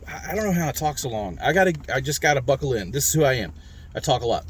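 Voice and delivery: ordinary talk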